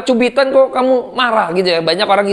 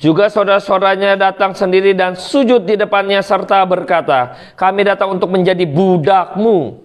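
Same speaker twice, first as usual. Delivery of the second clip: scripture reading. Acted. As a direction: read the passage solemnly from the pulpit. Reads scripture passage aloud.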